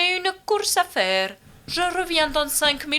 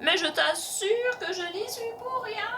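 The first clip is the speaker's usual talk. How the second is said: higher and softer, stifling a giggle; floating mum suppressing a giggle, higher and softer; holding back laughter; 7 semitones higher, 8 dB quieter than usual. Acted. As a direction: try not to burst out laughing. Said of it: "tente de réfréner un fou rire".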